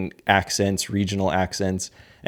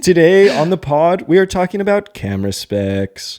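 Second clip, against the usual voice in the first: high, loud announcer-style podcast intro; hammed-up host read; 8 semitones higher, 5 dB louder than usual. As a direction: play a cheesy podcast host signing in. Mock podcast host intro, future.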